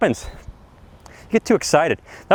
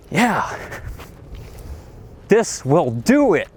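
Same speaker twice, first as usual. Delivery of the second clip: usual speaking voice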